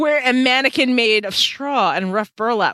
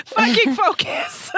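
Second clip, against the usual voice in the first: exasperated laugh; 12 semitones higher, slower than usual. Real, not acted. Scolding the character while laughing.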